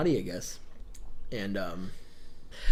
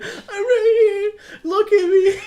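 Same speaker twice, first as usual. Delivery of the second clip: high, loud, slow tearful plea; crying child; 10 dB louder, much slower than usual. Retold or imitated. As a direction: wail to be noticed like a small child. Child pleading, ignored by parents.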